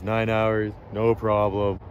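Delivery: normal talking voice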